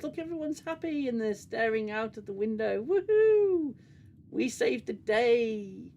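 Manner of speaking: high, sing-song fake happiness; mock cheer. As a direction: gush with sarcastic glee up to a hammed-up woohoo. Mocks the show's happy group ending.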